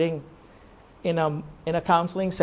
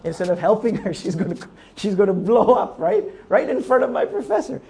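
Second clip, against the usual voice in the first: normal talking voice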